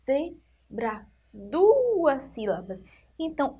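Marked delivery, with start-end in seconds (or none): 0.0-1.1 "Zebra" split into ze, bra; syllable by syllable. Shown as a demonstration. Teacher counting syllables for pupils, "Zebra" in two separate beats. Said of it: "que tem quantas sílabas?".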